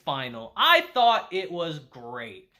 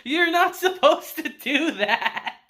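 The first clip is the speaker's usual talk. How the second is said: mock scolding; 11 semitones higher, 5 dB louder, slower than usual. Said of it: "Hayasaka's just sitting there like".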